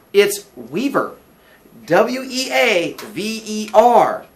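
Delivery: high, loud, slow spelling; Billy correcting. Acted. Billy corrects landlady on his surname, emphatically.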